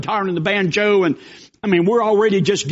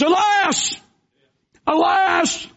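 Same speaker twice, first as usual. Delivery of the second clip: high alarmed cry of alas; terrified cry; 9 semitones higher, much slower than usual. Acted. Servant sees an army surrounding him, high frightened cry. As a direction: cry out in sudden panic, much higher.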